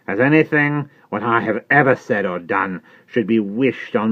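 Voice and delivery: slow, loud pulpit tone; Jerry sermonizing to Miss Pross, slow and loud; preachy Jerry. Acted. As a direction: hold forth like a preacher. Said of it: "additional solemnity, additional slowness".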